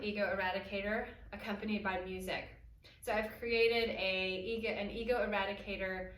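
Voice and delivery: usual speaking voice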